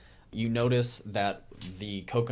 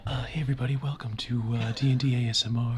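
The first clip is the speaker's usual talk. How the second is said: ASMR voice; 2 semitones higher, faster than usual. Pretend. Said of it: "That was actually pretty good".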